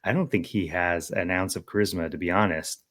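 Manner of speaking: ordinary delivery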